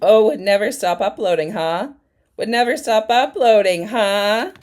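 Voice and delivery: loud, high, sarcastic parroting; mock-indignant jeer; mocking echo. Mocks the clip's words back.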